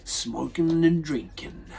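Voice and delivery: upbeat host welcome, drawing out the show name; podcast intro. Acted. Host greeting his listeners at the show's start, upbeat announcer read. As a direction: open the show like a radio host, slowing down on the ending.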